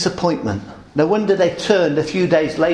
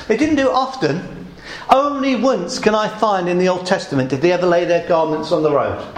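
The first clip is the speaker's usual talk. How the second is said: ordinary delivery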